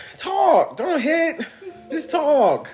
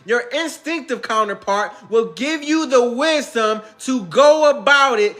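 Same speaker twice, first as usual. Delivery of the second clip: preachy guru sermon; 2 semitones higher than usual. Acted. Future self preaching divine wisdom, slow and solemn. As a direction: lay out the revelation slowly.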